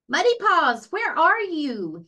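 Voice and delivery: high, loud, slowing call; worried calling. A narrator's reading. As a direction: call out searching for the puppy. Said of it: "he called".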